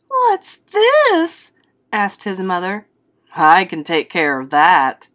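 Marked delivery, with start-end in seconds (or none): 0.1-1.5 very high mother's voice; mother's startled question. A narrator's reading. Mother asks about baby's tooth. 3.3-5.1 low, loud father voice; father's reply. A narrator's reading. Father answers the mother.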